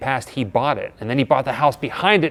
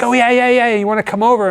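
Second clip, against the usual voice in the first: higher, loud and breezy recognition; a cheerful, suddenly friendly host; lightly voiced Tom remembering them; recalling Tom; 11 semitones higher, 6 dB louder, faster than usual. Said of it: "He's like".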